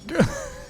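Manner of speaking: high, giggling laugh; high-pitched laugh. Real, not acted. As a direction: laugh at the near miss. Laughing after the mishap.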